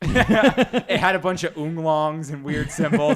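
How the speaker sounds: amused and exasperated; giggling explanation; laughing. Real, not acted.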